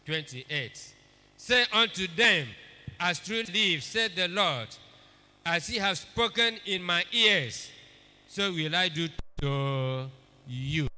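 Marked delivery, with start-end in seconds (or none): 1.4-10.9 loud, proclaimed Bible reading; forceful scripture reading. Acted. Preacher proclaiming the Lord's words loudly to the congregation. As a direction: declaim the verse to the hall.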